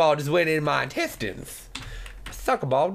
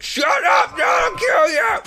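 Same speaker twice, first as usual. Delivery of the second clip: loud, high, hammed-up threat; mock angry shout; 10 semitones higher, 6 dB louder, slower than usual. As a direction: yell it at your friend in play rage. Mock fight with friend Dan.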